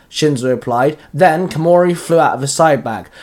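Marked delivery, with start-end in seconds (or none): none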